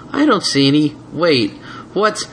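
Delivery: confused then startled; surprised. Acted. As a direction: caught off guard. Trap surprise.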